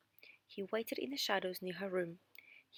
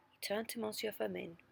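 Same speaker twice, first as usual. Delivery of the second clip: ordinary delivery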